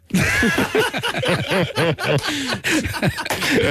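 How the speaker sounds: high, long laughing fit; real long laugh. Real, not acted. Laughing at the Italy-Madrid mix-up. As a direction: keep laughing at the punchline.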